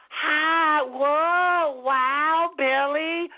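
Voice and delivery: put-on high, sing-song praise as if to a small child; mock the way people coo at a slow kid; condescending sweet talk. Mocking how people talk down to Billy, sugary child praise. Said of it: "Listen to how people talk to you".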